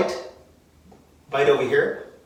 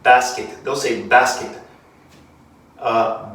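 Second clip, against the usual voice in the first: ordinary talk, nothing marked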